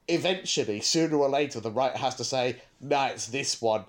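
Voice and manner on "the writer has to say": usual speaking voice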